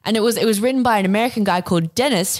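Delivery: ordinary talk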